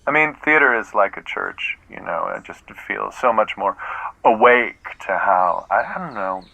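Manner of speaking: usual speaking voice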